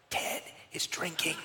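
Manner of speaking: slow, hushed whisper; alarmed whisper. Retold or imitated. Boy warning the other kids in the van, slow whisper. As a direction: whisper it like a dire secret.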